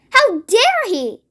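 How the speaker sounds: high, slow outraged question; mild narrated outrage; a girl outraged on the Queen's behalf; cried indignantly. A narrator's reading. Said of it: "Sophie cried".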